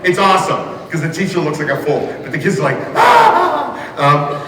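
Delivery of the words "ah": high-pitched mock squeal of delight. Kids watching a teacher look foolish, mock delighted squeal. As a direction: imitate thrilled kids shrieking.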